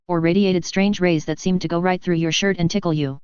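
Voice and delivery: ordinary delivery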